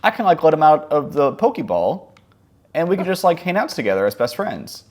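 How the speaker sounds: put-on hopeful kid imagining best friends; retold childish excitement. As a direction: voice lifts with naive delight. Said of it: "And I'm like".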